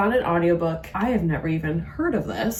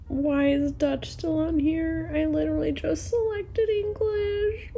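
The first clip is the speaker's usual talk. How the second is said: exasperated whine; 11 semitones higher than usual. Real, not acted. Frustrated browsing audiobooks.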